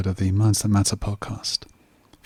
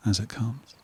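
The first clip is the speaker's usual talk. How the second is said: soft, unhurried meditation voice with long pauses; guided meditation; 5 dB quieter than usual. Real, not acted. Host guiding listeners to relax, calm meditation voice. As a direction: settle the listener into calm, phrase by phrase.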